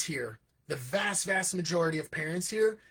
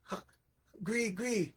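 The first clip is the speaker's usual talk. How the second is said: put-on baby voice; 5 semitones higher, 4 dB quieter than usual. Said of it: "the baby's like".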